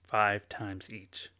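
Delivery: soft, slowed guided breathing instructions; gentle meditation guide voice. Acted. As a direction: ease them into calm, quietly and slowly. Leads a breathing exercise.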